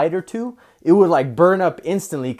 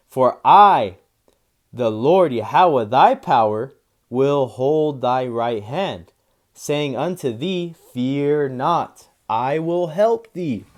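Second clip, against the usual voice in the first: slow, solemn reading, louder at the start; scripture reading; 5 dB louder, much slower than usual. Acted. Preacher reading the Lord's promise, slow and proclaiming. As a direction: proclaim the Lord's words slowly. Reads Isaiah 41:13.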